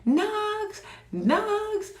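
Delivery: high frantic call for the lost dog. Retold or imitated. Owner searching the yard, calling her dog in a panic. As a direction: call the dog's name, panicking. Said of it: "running around like a lunatic screaming".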